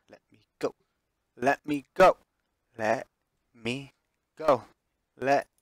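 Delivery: loud, broken begging cries; repeated pleading. Acted. Ashley pleading over and over, loud. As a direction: keep repeating let me go, fading. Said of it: "Ashley repeats the same thing over and over".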